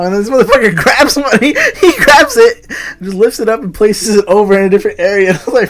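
real laughter breaking into excited storytelling; laughing while telling. Real, not acted. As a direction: tell it cracking up, pitch jumping high at the start. Cracking up telling the goose story.

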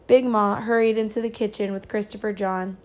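usual speaking voice

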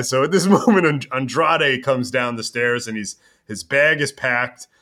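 usual speaking voice

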